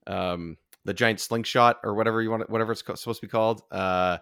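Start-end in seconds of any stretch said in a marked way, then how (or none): none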